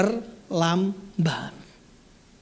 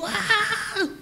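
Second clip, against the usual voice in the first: jeritan; 14 semitones higher, 5 dB quieter than usual. Acted. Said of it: "Dia teriak begitu".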